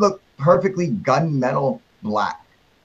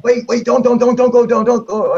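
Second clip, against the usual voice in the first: panicked plea; 8 semitones higher, 5 dB louder than usual. Retold or imitated. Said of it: "He's like".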